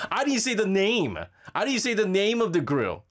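loud, insistent self-defense; mock-defensive protest. Real, not acted. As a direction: push back at the producer, high and emphatic. Protests producer's no-advertising warning.

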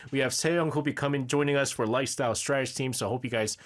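ordinary talk

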